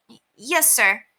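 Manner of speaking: high, hesitant stammer; nervous yes. Acted. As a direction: gulp it out. Henry nervously agrees.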